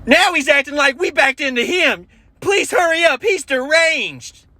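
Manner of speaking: very high frantic mock woman; panicked lady on phone. Lady panicking to the dispatcher, high hammed-up shriek. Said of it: "she's like".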